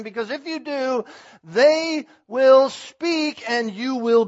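loud, rising fervent sermon voice; a preacher building to a loud climax; full-force preaching. Acted. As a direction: preach it hard to the congregation.

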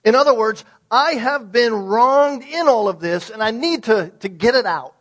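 loud, impassioned sermon delivery; full-force preaching. Acted. A pastor preaching on Job's suffering, loud and impassioned. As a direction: preach it out with rising fervor.

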